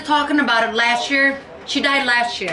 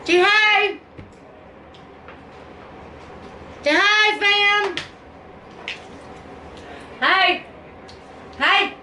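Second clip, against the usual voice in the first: much higher, playful coaxing voice; 9 semitones higher, 4 dB quieter, much slower than usual. Real, not acted. To the baby for the viewers, high baby talk.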